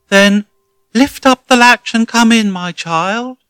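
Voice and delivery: high, loud, quavering granny voice; wolf hamming up a frail granny; trembling old lady. Acted. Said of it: "said the trembling voice".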